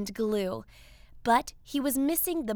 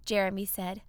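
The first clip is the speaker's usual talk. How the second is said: ordinary delivery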